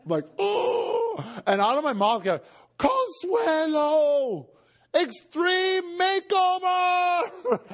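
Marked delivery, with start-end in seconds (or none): 0.4-1.4 a sharp, much higher "Oh!"; surprised gasp. Real, not acted. Preacher seeing Consuelo transformed, gasping. 2.8-7.6 drawn out very slowly, much higher; joyful exclamation. Retold or imitated. Preacher greeting Consuelo on the street, stretched out with joy.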